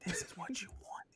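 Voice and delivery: whispered Long Island sweet talk; mock ASMR in a whisper. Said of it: "I need to look up an A S M R for that".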